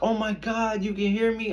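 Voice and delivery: high-pitched retold astonishment; mock gasping amazement, voice pitched well up; amazed disbelief. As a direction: imitate a girl startled at being overheard. Retold girl startled at being heard.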